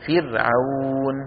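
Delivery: فرعون drawn out slowly with a thin ra; light ra recitation. Shown as a demonstration. Teacher reciting an example to students, light ra. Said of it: "إذا كانت ساكنة وقبلها كسرة أصلية".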